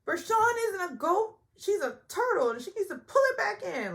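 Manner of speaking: high, loud mock delivery of a cast member's joke; Sonya's joke. Mimicking Sonya's goofy joke to the group, high and loud. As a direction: lightly squeal it out, playful. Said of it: "Sonya makes a joke".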